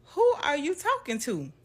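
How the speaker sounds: high, loud scolding grandma; fussing at a child talking to nobody. Retold or imitated. Grandma scolding her granddaughter outside, loud and high. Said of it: "my grandma come outside and she like".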